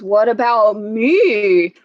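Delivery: a lightly voiced slow whale. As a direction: stretch the words out like a big whale. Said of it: "That's how whales talk".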